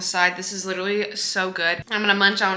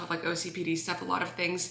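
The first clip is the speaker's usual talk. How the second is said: ordinary talk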